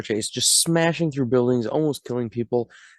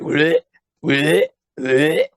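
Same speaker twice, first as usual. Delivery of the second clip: mock gagging; 3 semitones higher, 5 dB louder, slower than usual. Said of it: "gagging and stuff".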